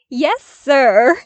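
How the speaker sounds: loud, high mock-salute call; teasing yes sir. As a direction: bark it like a cheeky cadet. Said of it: "he teased right back".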